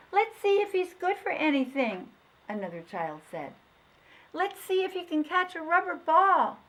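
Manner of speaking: put-on high, loud child voice; teasing child. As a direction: kids plotting a game. Children tease the dog.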